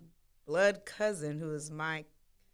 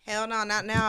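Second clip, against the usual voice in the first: higher and louder, a firm refusal; emphatic hell no; 6 semitones higher, 9 dB louder than usual. Real, not acted. Telling listeners she no longer hides, loud emphatic.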